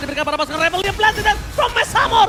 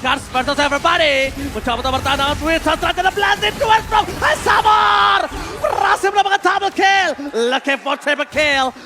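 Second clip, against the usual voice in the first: fighting game announcer; 3 semitones higher, 3 dB louder than usual. Real, not acted. Caster calls the teamfight.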